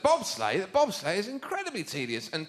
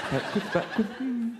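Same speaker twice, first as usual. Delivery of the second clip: mock smug skater; 5 semitones higher than usual. Mocking ice skaters again.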